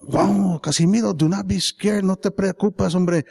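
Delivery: high, anxious talking to oneself; scared self-reassurance. Acted. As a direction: try to calm yourself while frightened. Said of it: "and it scares me".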